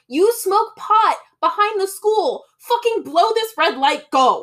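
high, slow, loud mock yell at the driver; high frantic urging. [name] yelling at Bailey to run the light, high and loud.

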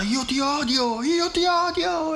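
put-on very high, slow, drawn-out child's wail; screaming like mad. A narrator's reading. Little girl at the checkout screaming hatred at her mother, slow shrill howl. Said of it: "urlava come una matta".

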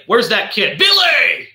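loud mock yell up the stairs, high; calling out. Grumpy father calling his son down, mock yell. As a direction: bellow for the kid. Dad yelling for son in retelling.

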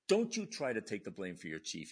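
loud imitation of an irate superior; retold angry rebuke. Officer rebuking junior for covering chief, retold angry rebuke. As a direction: shut him down firmly, as if quoting. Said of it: "getting yelled at by the E".

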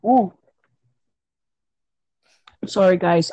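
chewing while talking; speaking through a mouthful; mouth full. Real, not acted. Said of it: "I'm eating".

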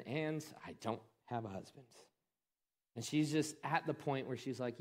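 ordinary talk, nothing marked

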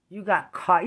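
loud sharp you got caught; loud accusation. Real, not acted. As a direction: throw it back at them, loud. Repeated accusation, second loud.

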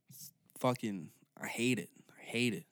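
normal talking voice

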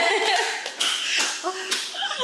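high burst of laughter; loud laugh. Real, not acted. Laughing after joke.

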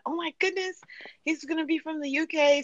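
playful retold swooning; imitated excited friends. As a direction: lightly mimic a friend getting excited. Voices friends' excited guess.

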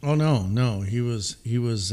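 ordinary delivery

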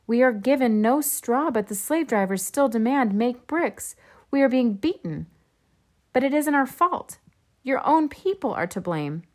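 pleading protest. A narrator's reading. Foremen protesting their beatings to Pharaoh, urgent pleading. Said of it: "pleaded with him".

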